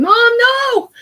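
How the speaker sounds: put-on high, loud panicked child's cry; son's terrified scream. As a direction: scream like a child watching his mother fall away. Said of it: "I can hear my son screaming".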